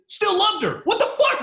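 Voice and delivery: high, loud disbelieving yell; outraged shout. Real, not acted. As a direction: explode in disbelief. Outraged rant about delusional woman.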